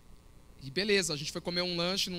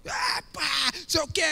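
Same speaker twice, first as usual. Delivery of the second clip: mock shouting tantrum; 8 semitones higher, much slower than usual. Pastor imagining himself throwing a fit, high mock yelling. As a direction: parody a pastor making a scene.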